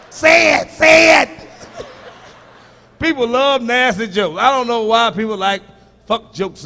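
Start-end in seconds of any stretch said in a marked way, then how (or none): 0.1-1.3 higher, louder say it; shouted dare at the crowd; taunting shout. Pretend. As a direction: egg them on with a shout. Taunting laughing audience.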